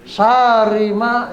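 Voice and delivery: "Syarri" as saa-ri, stretched a, single r; slow "Syarri ma" with the a dragged out; wrongly lengthened. Shown as a demonstration. Teacher showing the wrong reading, long a in "Syarri". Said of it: "ya, salah tuh".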